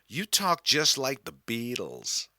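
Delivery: a lightly done, friendly American stranger; chatting to a boy from Liverpool in a light American accent; friendly American woman. Retold or imitated. American woman remarking on his accent.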